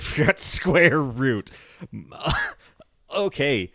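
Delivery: ordinary delivery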